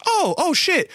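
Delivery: very high retold surprise; high-pitched acted-out amazement; startled recognition. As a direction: imitate a friend suddenly impressed.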